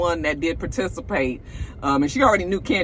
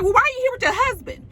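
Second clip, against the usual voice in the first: prying question; faster than usual. Retold or imitated. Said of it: "And she was like".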